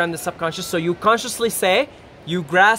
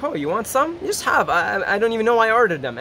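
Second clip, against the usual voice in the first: higher, fast, breezy friend offering fries; tempting friend; 4 semitones higher than usual. Retold or imitated. Friend at the pizza place offering his fries, high and fast. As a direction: toss the offer off like it means nothing. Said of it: "he's like".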